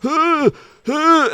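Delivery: a sharp high gasp as the breath is squeezed out; winded gasp. Acted. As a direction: yelp like you've been crushed in a hug. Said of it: "you get the breath knocked out of you".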